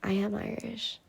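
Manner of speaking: very soft whispered reply; hushed, voiceless answer. Real, not acted. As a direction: whisper it back. Whispered reply about being Irish.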